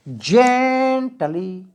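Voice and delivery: "Gently" drawn out on "जें", light "टली"; stress on first syllable. Shown as a demonstration. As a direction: stretch and push the opening of "gently". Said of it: "इसको जोर से बोलेंगे, इसको धीमे". ("Gently" का पहला हिस्सा खींचकर, ज़ोर से)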